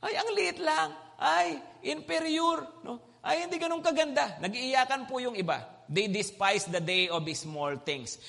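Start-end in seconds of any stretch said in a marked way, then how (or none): 0.1-4.4 higher, mildly whiny lament; mock lament. Preacher mimicking the weeping temple builders, light mock whine. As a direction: mimic complaining people lightly. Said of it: "sabi nila".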